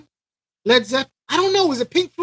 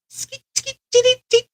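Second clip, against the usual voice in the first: high skeet scratch sounds; quick high scratching mimicry; scratch noise. Imitating records being scratched.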